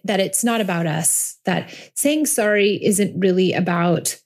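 ordinary delivery

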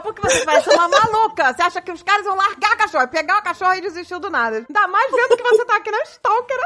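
indignado, em tom agudo; bronca encenada. Retold or imitated. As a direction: imita o marido incrédulo dando bronca. Dave repreende a esposa maluca. (bronca aguda e divertida)

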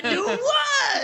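high-pitched call back from afar; distant yell. Acted. Player answers a yell from in the distance, high drawn-out shout. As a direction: holler it as if far off, amused. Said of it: "In the distance".

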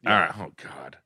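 ordinary talk

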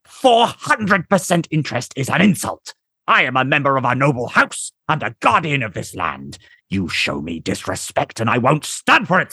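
high, indignant aristocrat voice; affronted nobleman. A narrator's reading. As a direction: threaten like an insulted noble.

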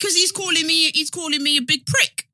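loud, high-pitched mock indignation; livid Essex dad. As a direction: act out an outraged Essex dad's complaint. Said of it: "I was fucking livid".

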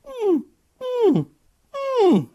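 high, thin whimpering hum, mouth closed; whiny moose moan. Retold or imitated. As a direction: imitate a moose's faint whiny call. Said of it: "very faint sort of whiny, like".

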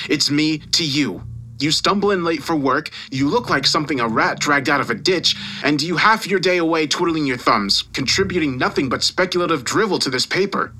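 emphatic scolding with leaping pitch; irate boss dressing someone down; angry tirade. Acted. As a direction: tear into a useless employee. Boss's furious tirade down the phone.

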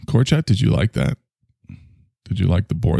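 ordinary talk

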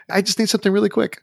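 high, hurried mock plea; pleading late customer. Customer showing up as they're closing the doors, hurried mock plea.

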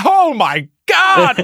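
high, drawn-out hammed-up shriek; stretched, squealed mock outrage; mock horror. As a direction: overplay scandalized shock. Exaggerating Tina's shocked reaction.

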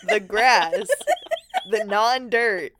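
laughing, repeating the phrase mockingly; laughing mockery. Real, not acted. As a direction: giggle through the silly phrase.

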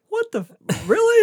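high, stunned what the f; shocked disbelief. Retold or imitated. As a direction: caught off guard, voice jumping up.

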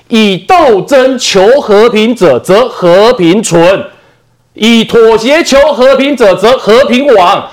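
each phrase drawn out slowly and weighed; slow solemn recital. Retold or imitated. Reciting Mao's maxim. (缓慢庄重地朗诵)

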